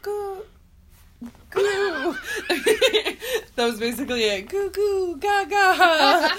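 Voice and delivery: put-on high baby talk, laughing through; baby babble. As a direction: coo like a smitten infant. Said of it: "She just was like".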